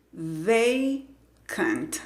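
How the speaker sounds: VACANT split slowly into two syllables; sílaba por sílaba. Shown as a demonstration. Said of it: "La primera sílaba". (VACANT separado en sílabas, lento)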